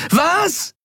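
very high, frantic yell; screamed question. A narrator's reading. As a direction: shout it back, unable to hear. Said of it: "schrie sie".

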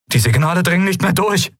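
high, urgent report; calling out. A narrator's reading. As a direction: call the bad news across the bridge. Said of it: "rief er".

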